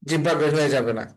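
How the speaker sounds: usual speaking voice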